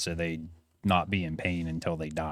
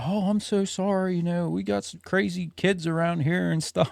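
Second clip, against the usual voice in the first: high-pitched imitation of a woman apologizing; apologetic wife; 11 semitones higher than usual.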